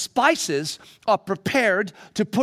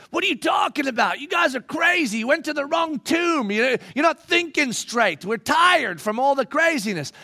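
higher, louder scornful shouting; scoffing; 8 semitones higher, 4 dB louder than usual. Acted. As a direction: dismiss them loudly. Disciples arguing with women.

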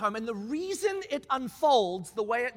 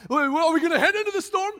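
mock confused question; 5 semitones higher, 6 dB louder, faster than usual. Mocking his own confused question.

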